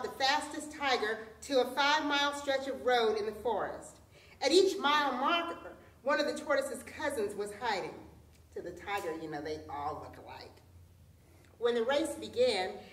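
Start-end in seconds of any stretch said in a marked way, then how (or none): none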